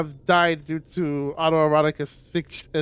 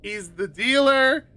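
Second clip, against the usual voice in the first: high, rushed, flustered inner voice; racing thoughts; 7 semitones higher, 3 dB louder than usual. Retold or imitated. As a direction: babble it like a panicking mind. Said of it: "my mind's just like".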